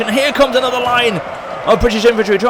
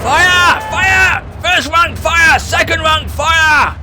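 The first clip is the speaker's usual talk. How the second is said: shouted fire orders; 9 semitones higher, much slower than usual. Acted. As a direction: bark the volley orders out. Ordering volleys in battle.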